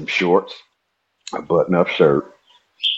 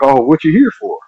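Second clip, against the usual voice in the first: higher questioning voice; curious guy; 8 semitones higher, 3 dB louder than usual. Retold or imitated. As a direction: play the guy prying. Guy questions him, retold.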